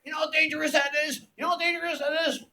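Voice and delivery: high, fast, exasperated scolding. Retold or imitated. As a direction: hammer the point home, appalled. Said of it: "she went up one side of me, down the other".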